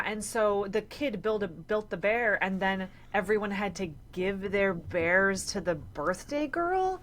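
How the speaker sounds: normal talking voice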